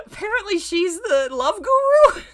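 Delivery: laughing real disbelief at the story. Real, not acted. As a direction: crack up through the words. Reader cracks up at story.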